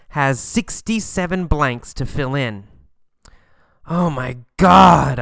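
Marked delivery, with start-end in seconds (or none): 3.9-5.2 loud, slow, exasperated oh my God; loud drawn-out groan of dismay; exasperated outburst. Acted. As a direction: moan it out loud in disbelief. Overwhelmed by endless hospital forms.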